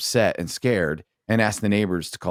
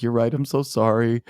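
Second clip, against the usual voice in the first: higher, mock-tearful apology; 4 semitones higher than usual. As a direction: imitate a mother giving in tearfully. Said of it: "she just breaks down in tears".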